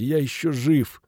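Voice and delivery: weak, pained exclamation; groaned protest. A narrator's reading. Injured Futaki protesting he is still alive, groaning. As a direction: moan it from the floor. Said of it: "простонал Футаки".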